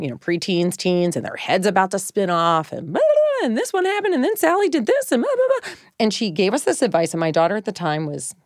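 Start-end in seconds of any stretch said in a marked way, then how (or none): 2.7-5.8 high, sing-song mimicry of a ranting teen; mock teen rant. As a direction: rattle it off like a breathless preteen.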